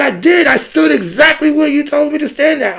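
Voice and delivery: indignant protest. Retold or imitated. Speaker protesting to the sergeant, high indignant voice. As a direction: push back hard at an unfair order.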